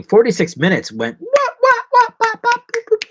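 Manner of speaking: high squeaky wah wah noises; silly wah sounds. Shown as a demonstration. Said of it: "like that".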